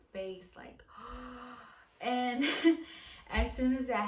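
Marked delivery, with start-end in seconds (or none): 0.9-1.7 sharp gasp. Retold or imitated. Seeing two lines on test. 2.5-3.3 stunned breathy laugh. Retold or imitated. Reacting to positive pregnancy test.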